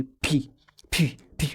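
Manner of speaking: aspirated P, بي with a burst of breath; strong puff of air. Shown as a demonstration. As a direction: blow air out on بي, as onto paper. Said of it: "هتلاقي فيه هوا بيطلع". (انطق «بي» P بهوا طالع من البق)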